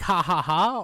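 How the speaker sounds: loud, hammed-up fake laughter; mock laugh. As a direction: laugh it off like it's nothing. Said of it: "laugh about it like".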